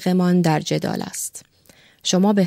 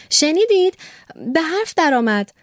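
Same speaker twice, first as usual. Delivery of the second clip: put-on childlike joyful cry; 9 semitones higher than usual. Young secretary cries to the doctor in childlike joy. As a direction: burst out like a gleeful child.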